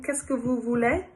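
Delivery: plain reading voice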